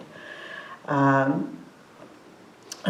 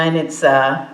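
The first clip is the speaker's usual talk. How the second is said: ordinary talk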